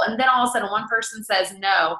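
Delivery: usual speaking voice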